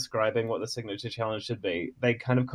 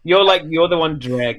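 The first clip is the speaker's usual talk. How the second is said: excited; 5 semitones higher, 10 dB louder than usual. Real, not acted. Laughing accusation.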